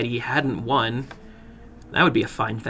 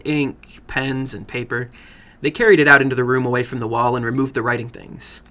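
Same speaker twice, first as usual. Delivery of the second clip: ordinary delivery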